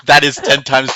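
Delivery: words squeezed through laughter; real laughing. Real, not acted. Narrator praising a friend's improvised line, laughing hard. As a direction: talk while cracking up.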